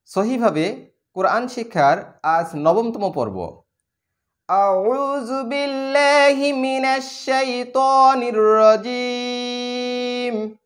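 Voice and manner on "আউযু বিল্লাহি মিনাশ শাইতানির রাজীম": slow, melodic chanted recitation; Quranic recitation. Acted. Teacher opening the Quran lesson with slow chanted recitation. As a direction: intone each word slowly like tilawat.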